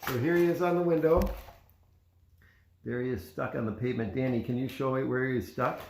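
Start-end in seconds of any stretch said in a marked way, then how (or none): none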